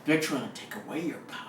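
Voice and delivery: low, hushed sinister manipulator; the Emperor. Acted. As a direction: drop it low and soft, planting suspicion. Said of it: "Sound like the Emperor".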